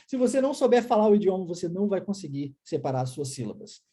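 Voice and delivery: ordinary talk, nothing marked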